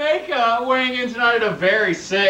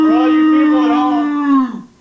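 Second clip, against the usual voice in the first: announcer voice; 6 semitones higher, 6 dB louder than usual. Pretend. Addressing viewers like announcer.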